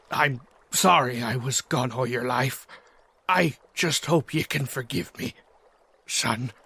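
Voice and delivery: soft, low, tender father's voice. Acted. Father finally meeting his son, tender and soft. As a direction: speak gently to the son you lost.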